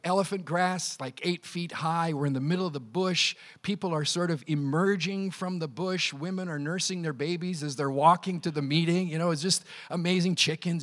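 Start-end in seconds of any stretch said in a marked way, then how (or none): none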